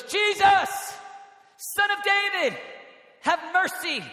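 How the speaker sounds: high, loud calling out; desperate cry. Acted. Blind Bartimaeus calling to Jesus for mercy, crying out. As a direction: belt it out in separate bursts. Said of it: "something in the heart of Bartimaeus that goes".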